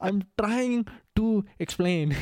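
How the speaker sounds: put-on slow, high Indian accent. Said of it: "starting to burst into the accent".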